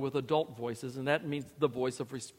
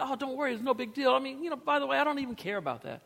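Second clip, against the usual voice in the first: a hint of a breezy buddy, pitched higher; guy talk; 9 semitones higher, faster than usual. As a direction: toss it off lightly like a dismissive friend. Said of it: "That's guy talk".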